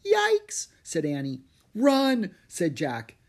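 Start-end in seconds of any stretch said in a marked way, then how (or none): 0.0-0.7 very high, loud cry of alarm; high-pitched panicked exclamation; startled yelp. A narrator's reading. Girl startled by approaching samurai. 1.8-2.3 loud, high cry to flee; sudden panicked yell; urgent cry. A narrator's reading. Boy urging escape from samurai.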